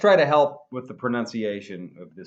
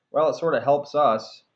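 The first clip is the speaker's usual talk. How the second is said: ordinary talk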